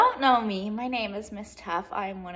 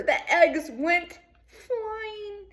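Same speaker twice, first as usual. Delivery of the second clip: slow, higher, drawn-out suspense; dramatic slow-down; 10 semitones higher, much slower than usual. Acted. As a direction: stretch it out as the eggs fly. Dramatic moment in story.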